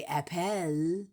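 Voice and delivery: "apple" as ap-PELL, ending drawn on the E; spelling pronunciation. Shown as a demonstration. Mocking the spelling, "apple" said ap-PELL. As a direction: voice a clear EH in "apple". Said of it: "unless you're gonna say".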